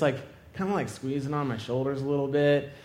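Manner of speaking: ordinary talk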